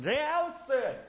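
high-pitched mock holler; playful yell. Husband yelling back to Allison in the house, mock holler. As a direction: call out high to someone indoors. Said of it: "yell to Allison".

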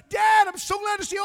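high, full-force joyful shout; loud, elated pulpit cry; shouting praise. Retold or imitated. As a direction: shout it out to Dad with all your force. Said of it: "I'm just shouting the praise".

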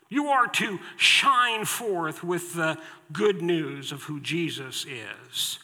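ordinary talk, nothing marked

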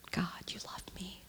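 whispered prayer to God. Retold or imitated. Woman whispering a prayer to God in the mirror. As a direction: breathe it out, barely voiced. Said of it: "I look in the mirror and I say".

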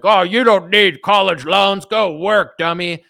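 high, slow, mocking dad voice; mocking Trump-supporter dad. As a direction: sneer it like a condescending dad. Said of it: "your dad is a Trump supporter and is like".

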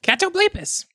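high-pitched kobold. Acted. Kobold pointing at himself, high kobold voice. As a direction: say your name high as a kobold. Kobold repeats creature's name.

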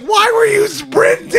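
high, loud, slow terrified cry; mock horrified wife; panicked shriek. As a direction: scream it like a panicked mother. Wife terrified about baby.

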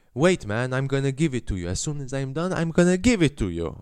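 irritated mock Italian-accented English, rising in pitch; sour Italian accent. Cashier to waiting customer, irritated Italian-accented English. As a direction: play an annoyed Italian pizza man. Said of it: "han kollar på mig fett sur".

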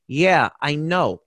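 slow, drawn-out mock acknowledgement; a lightly imitated, unhurried brush-off; Robert De Niro. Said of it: "looks over his shoulder at Joey".

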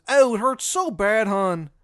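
high, loud, slow mock wail; pained Shithead Steve. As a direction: cry out in hammed-up pain. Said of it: "And he goes".

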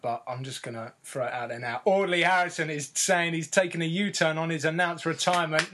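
usual speaking voice

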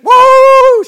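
high, loud, held-out whoop; praise whoop. Retold or imitated. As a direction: let out an imitated church shout of joy. Acts out her praise whoop.

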